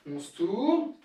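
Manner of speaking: ordinary delivery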